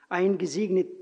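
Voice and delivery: ordinary talk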